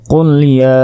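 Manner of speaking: recited Quranic style; Quran recitation. Shown as a demonstration. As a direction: chant it as in tilawat. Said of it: "টেনে পড়তে হবে".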